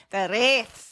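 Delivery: "wraiths" with a rolled Irish r. Shown as a demonstration. Host shows co-host the tongue roll, rolled r on "wraiths". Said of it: "that's where I said the, the wraith".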